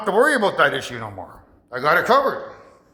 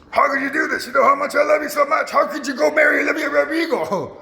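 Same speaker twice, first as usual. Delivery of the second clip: high, hurt pleading voice, a character; mock upset ex; 8 semitones higher than usual. Ex scolding him for marrying another, high hurt plea. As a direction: whine it like a wounded ex-lover. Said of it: "she gets mad at me and says".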